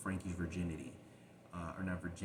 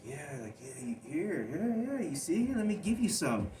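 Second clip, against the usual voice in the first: retold coaxing abuser; 7 semitones higher, 5 dB louder than usual.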